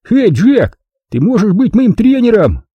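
high, eager shout-out; excited calling out. Acted. Wilson turns to Jack with a request, eager high call.

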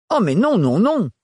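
high, sing-song no no no; protesting. A narrator's reading. Renard refuses to let her go, high and protesting. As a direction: swat the idea away. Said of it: "protesta Renard".

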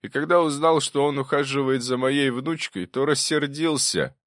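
firm up as you confess your anger; voice growing firmer. A narrator's reading. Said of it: "Голос профессора окреп". (голос крепнет)

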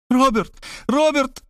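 high tearful scream of a name; crying out in tears. Acted. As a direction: cry his name, sobbing. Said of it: "закричала она, заливаясь слезами".

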